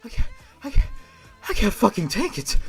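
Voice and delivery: ordinary talk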